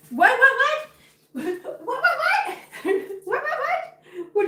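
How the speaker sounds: very high laughing pet talk. Real, not acted. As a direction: squeal at the cat playfully. Talking to her cat.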